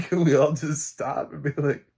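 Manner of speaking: amused plea; laughing. Real, not acted. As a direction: giggle as you ask. Laughing at her own idea.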